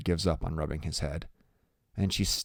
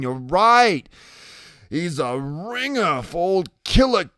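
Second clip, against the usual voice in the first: loud, higher voice easing down slowly; drawn-out dawning realization in a character voice; Iggy, struck by recognition; 7 semitones higher, 6 dB louder, much slower than usual. Acted.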